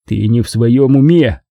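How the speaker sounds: ordinary delivery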